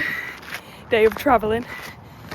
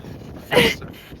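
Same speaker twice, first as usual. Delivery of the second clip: airy laugh; 12 semitones higher than usual. Real, not acted. Laughing at the wine.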